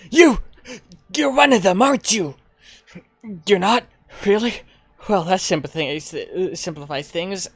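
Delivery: accusing then dismissive stranger; suspicious stranger. Acted. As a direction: confront them, then back off. Voices suspicious NPC.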